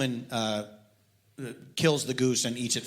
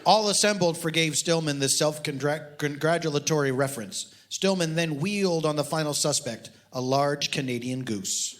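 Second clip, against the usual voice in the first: deadpan reading of a mock detective novel; story reading; 4 semitones higher than usual. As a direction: read it dry, like a serious audiobook. Author reads novel aloud.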